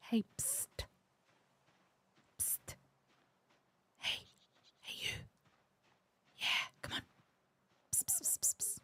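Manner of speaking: whispered psst and hey; psst for attention. Real, not acted. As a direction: hiss quietly to get attention. Whispering to lure zombie.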